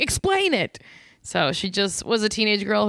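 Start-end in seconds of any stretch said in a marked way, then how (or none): 0.0-0.8 high, curt imitation of the dad; mock dad demand. Podcaster mimics the dad brushing off his daughter, high and curt. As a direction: toss it off like a dismissive father. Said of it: "he'll be like".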